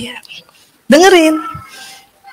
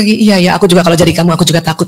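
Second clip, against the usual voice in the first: lower, soft, caring; sympathetic; 7 semitones lower, 3 dB quieter, faster than usual. Shown as a demonstration. To a grieving friend, soft and sympathetic. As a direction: comfort her softly. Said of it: "Lalu ke".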